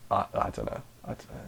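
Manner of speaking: unvoiced murmur, fading to nothing; a throwaway whisper, as if to yourself; sheepish whisper that disappears; whispered aside. Real, not acted. Mock hashtag aside, whispered.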